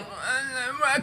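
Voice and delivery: high, strangled wordless uh; unable to talk. Acted. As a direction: try to answer but can't form words. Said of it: "'cause you can't talk".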